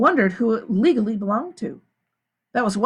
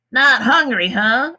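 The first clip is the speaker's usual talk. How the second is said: a gruff taunt, louder; Mister Sir; 4 semitones higher, 7 dB louder, slower than usual. A narrator's reading. Mister Sir mocks Stanley, gruff and loud. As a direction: needle the boy with a sneer. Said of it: "said Mister Sir".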